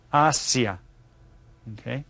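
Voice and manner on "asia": Asia with an open Spanish a, as in ah-see-ah. Shown as a demonstration. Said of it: "pronounced a little bit different".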